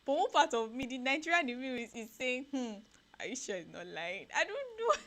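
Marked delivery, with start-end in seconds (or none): none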